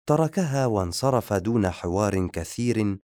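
ordinary delivery, nothing marked

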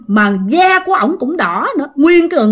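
usual speaking voice

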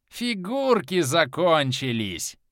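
high, slow, gleeful madman; unhinged cackling villain; mad god. A narrator's reading. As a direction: relish it like a crazed child. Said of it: "Безумно засмеялся Кармадонт".

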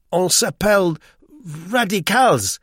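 high, loud, tentative French; doubtful girl guessing at a word. A narrator's reading. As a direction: try out the French word, unsure of it. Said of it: "said the girl doubtfully".

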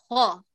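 خا said emphatic, full and heavy; heavy kha demo. Shown as a demonstration. Teacher showing heavy خا to students. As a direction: give خا its heavy thick sound. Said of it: "فمثلًا كلمة خلق".

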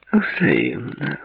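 high, languorous, suggestive woman's voice; drawn-out, promising. A narrator's reading. Woman returning David's greeting, in a drawn-out promising tone. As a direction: stretch it out with a hint of promise. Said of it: "отозвалась она тягучим, многообещающим тоном".

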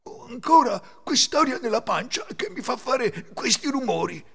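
high, whining worried wolf. A narrator's reading. Wolf troubled by his noisy belly, worried complaint. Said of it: "Il lupo era preoccupato".

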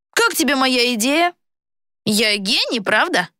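put-on high-pitched, self-satisfied boy villain; boy traitor, gloating.

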